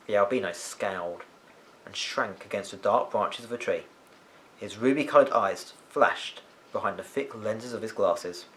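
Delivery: ordinary delivery, nothing marked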